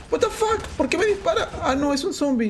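high, incredulous yelp easing as realization lands; startled outburst. Real, not acted. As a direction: start in high-pitched alarm, then settle a little. Startled by being shot at.

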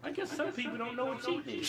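usual speaking voice

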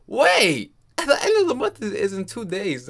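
sudden high laughing realization; surprised. Real, not acted. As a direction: catch yourself, laughing. Realizes deadline mid-sentence.

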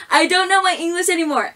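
high, fast, laughing self-mockery. Real, not acted. Laughing at own slip.

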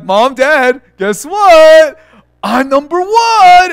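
loud, high bragging announcement, acted out; hammed-up triumphant shout; boastful. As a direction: crow it like a kid showing off. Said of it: "I would brag".

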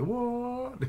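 very high, stretched-out disbelief; stunned reaction. Acted. Friends stare at each other, stunned reaction. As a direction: squeal it in mock astonishment. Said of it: "They stare at each other".

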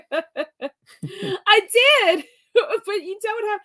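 high, loud words through laughter; laughing. Real, not acted. Answering a friend in chat, laughing through the words. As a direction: crack up while trying to talk.

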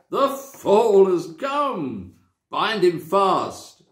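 slow, high, gloating command; fierce witch. A narrator's reading. Witch ordering her followers, slow and gloating. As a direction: savour every word like a cruel witch. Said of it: "gave a wild, fierce laugh".

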